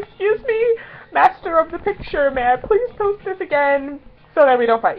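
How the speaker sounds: high, over-polite asking; bow and scrape playfully; mock polite. Fan asking a friend for permission, mock formal. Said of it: "I was like, so".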